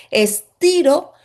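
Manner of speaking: "estiro" pulled out slowly; stretched word. Shown as a demonstration. Teacher acting out the stretch, slow. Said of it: "estiro, digámoslo".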